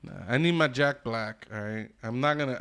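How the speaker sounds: ordinary talk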